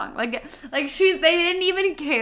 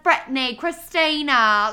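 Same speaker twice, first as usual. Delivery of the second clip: louder, higher hammed-up British cries; mock screaming; 4 semitones higher, 4 dB louder, slower than usual. Club owner screaming pop names, hammed-up mock shout.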